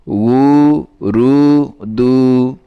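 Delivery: each syllable drawn out and separated; slow syllables. Shown as a demonstration. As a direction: pull উ রু দু apart slowly, one by one. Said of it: "syllables split then joined". (উ রু দু ধীরে আলাদা আলাদা করে)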